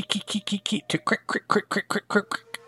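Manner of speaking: high, soft "ki-ki" ticking turning to "crick"; rapid-fire mock clicking noises; imitated creature clicking. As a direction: make small sharp repeated clicks in a high voice. Imitates arrows and blows on shell.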